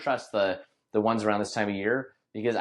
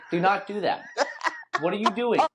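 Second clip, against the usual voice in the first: exasperated scolding; 10 semitones higher than usual. Retold or imitated. Retold scolding of the baby.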